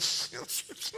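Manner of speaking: soft, wordless sniffs; mock sniffling. Peter regrouping after crying, mock sniffles. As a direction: sniff wetly as if pulling yourself together.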